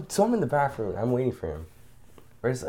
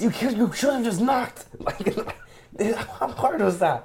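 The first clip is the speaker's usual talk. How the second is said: high slow mock voice; 12 semitones higher, slower than usual. Imitating complaint.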